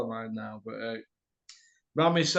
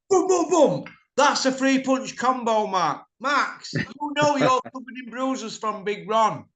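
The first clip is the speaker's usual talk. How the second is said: higher, louder cocky imitation; mock Big Ron boast; 7 semitones higher, 3 dB louder than usual. Teasing Max about his punch combo, cocky imitation. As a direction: ham up the braggart showing off his punches.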